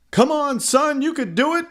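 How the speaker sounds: high, encouraging cheer; high-pitched fatherly encouragement. A narrator's reading. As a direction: cheer your son on. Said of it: "cheered Mike".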